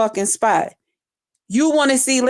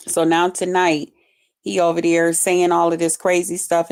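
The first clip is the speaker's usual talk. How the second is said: ordinary delivery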